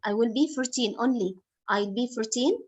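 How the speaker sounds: normal talking voice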